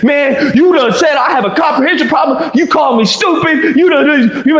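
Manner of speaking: high-pitched imitation of an indignant complaint; Keith getting upset. Retelling a colleague's reaction to an insult, mock upset. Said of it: "So Keith starts getting upset".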